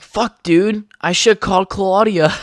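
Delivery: put-on sudden worry about calling girlfriend; retold panic at the phone; panicked realization. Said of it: "I was like".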